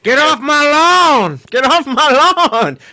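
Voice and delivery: put-on high, loud, slow old codger yell; hollering cranky old prospector. Angry old man yelling at trespassers.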